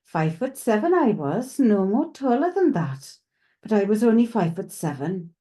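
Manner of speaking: sing-song Welsh lilt with swinging pitch; Welsh tilt. Retold or imitated. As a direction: channel the voice of a Welsh man. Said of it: "he's speaking in a Welsh tilt".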